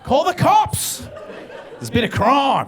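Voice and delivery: high falsetto cry for the cops, slow and laughing; hammed-up alarm, squealed through laughter; mock panic. Mock panicked bystander calling out.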